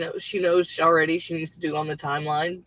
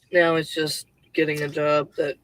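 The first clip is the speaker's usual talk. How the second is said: ordinary talk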